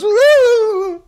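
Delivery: high, loud mock-crying "woo"; hammed-up wail, close to laughing. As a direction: imitate her bawling, loud and high. Imitates her wailing after being hit.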